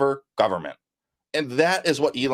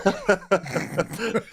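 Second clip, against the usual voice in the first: laughing; 8 semitones higher than usual. Real, not acted. Laughs at swallowing a bug.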